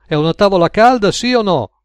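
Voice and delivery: high, loud challenge; irritated, insistent question; exasperated customer. A narrator's reading. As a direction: demand an answer, pitched well up.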